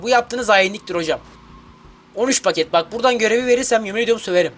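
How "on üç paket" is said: usual speaking voice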